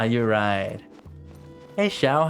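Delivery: ordinary talk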